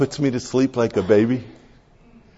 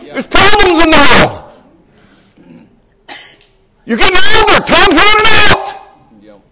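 rising, louder and higher worried rush; anxious inner voice; 13 semitones higher, 5 dB louder than usual. Retold or imitated. His racing mind at night, building anxious alarm. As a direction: let the worry climb higher and louder. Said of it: "my understanding says".

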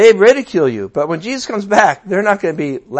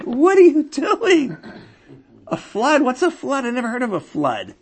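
incredulous neighbor; 7 semitones higher than usual. Retold or imitated. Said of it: "his neighbor's like".

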